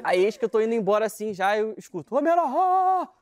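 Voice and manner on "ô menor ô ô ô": loud call from afar, pitched up; shouting. Retold or imitated. Policeman yelling after the boy. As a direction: yell after him across the hospital. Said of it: "uma gritaria".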